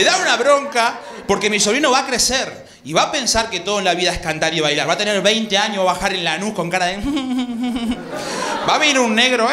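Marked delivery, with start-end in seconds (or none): none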